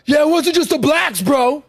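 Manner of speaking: imitating the maga guy in the argument, lightly voiced. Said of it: "and he goes".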